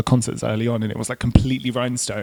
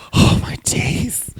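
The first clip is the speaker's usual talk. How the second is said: slow, breath-only exclamation; gasped whisper; much slower than usual. Acted. Medusa character reacting to her rock-hard customers, in a slow whisper. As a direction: gasp it out in a mock-shocked whisper.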